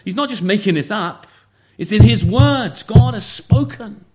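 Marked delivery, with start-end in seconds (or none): 1.8-4.1 louder and slower, each word weighted; firm emphasis. Real, not acted. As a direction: land "in his words" and "God has spoken" like a verdict. Preacher stressing scripture's authority.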